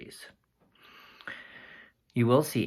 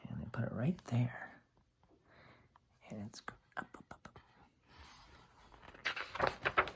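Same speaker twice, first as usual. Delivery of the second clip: whisper; 10 dB quieter than usual. Acted. Whispering as seed grows.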